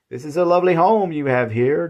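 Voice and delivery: eager praise with a lift; lively. A narrator's reading.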